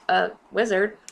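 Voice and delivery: ordinary talk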